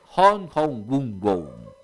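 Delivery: Hong Hong Gun Go sílaba por sílaba, con fuerza; pronunciá Hong Hong Gun Go como un nombre extranjero difícil; nombre difícil de pronunciar. Real, not acted. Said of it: "Dios mío, para pronunciarlo". (Hong Hong Gun Go, pronunciación trabajosa)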